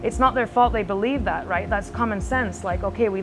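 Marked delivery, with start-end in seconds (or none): none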